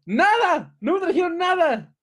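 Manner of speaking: put-on high, loud outraged kid's protest; angry retold complaint. Child telling parents the Reyes brought nothing, high angry complaint. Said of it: "y yo me enojé".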